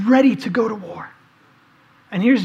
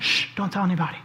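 softer, lower, hushing them like a secret; hushed warning; 4 semitones lower, 6 dB quieter, faster than usual. Retold or imitated. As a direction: shh it away, softly and low. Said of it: "he keeps saying".

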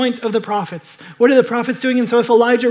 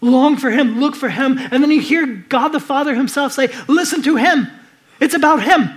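higher, louder, urgent pulpit drive; fervent raised preaching; preaching at full force; 4 semitones higher, 6 dB louder than usual. Real, not acted. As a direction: build the sermon to its climax. Climactic preaching.

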